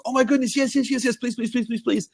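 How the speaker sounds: high, rapid-fire mock begging; eagerly pleading fan. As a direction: imitate an overjoyed fan saying yes. Retelling fan agreeing to a photo.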